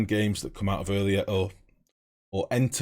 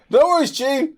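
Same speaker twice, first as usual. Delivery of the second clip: loud, high mock yell; high-pitched jokey bellow; sudden playful shout; 9 dB louder than usual. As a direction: holler it out abruptly. Shouts reply to chat capitals.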